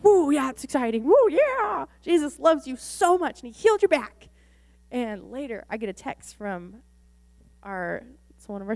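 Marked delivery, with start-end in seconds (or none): 0.0-4.3 high, loud whooping cheer; hyped up. Retold or imitated. Hyping the healed girl.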